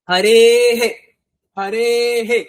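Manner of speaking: হরে হে, with a separate echoing হে after the বিসর্গ; ending pronounced as he. Shown as a demonstration. Teacher showing students how to say the visarga, a clear হরে হে. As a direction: say হরে, then a short breathy হে for the visarga. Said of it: "এরকম ভাবে উচ্চারণ করতে হবে".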